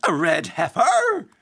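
loud, high, drawn-out mock Irish; bad Irish accent. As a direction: parody a terrible stage-Irish film line. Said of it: "It's as bad as that".

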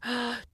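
delighted little gasp; a happy intake of breath. A narrator's reading. Finds raisins in pocket.

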